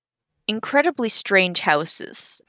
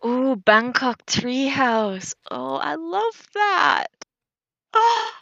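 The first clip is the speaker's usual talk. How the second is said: excited; 7 semitones higher than usual. Real, not acted. Delighted gasping at treehouse photos.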